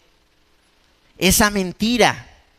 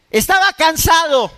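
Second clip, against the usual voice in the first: loud, high defensive excuse; mock defensive shout; 8 semitones higher, 4 dB louder than usual. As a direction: snap the excuse out. Retold excuse for violence. (excusa gritada, defensiva y en burla)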